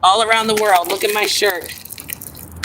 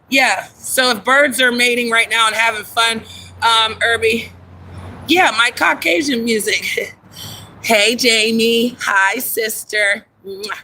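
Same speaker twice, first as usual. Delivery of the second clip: ordinary talk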